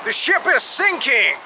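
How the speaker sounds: high, urgent shout; shouted command. A narrator's reading. Captain ordering passenger into the rowboat, shouting. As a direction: order him to move at once. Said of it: "shouted the captain".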